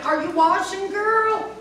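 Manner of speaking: high call through a door. Acted. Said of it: "The witch called through the door again".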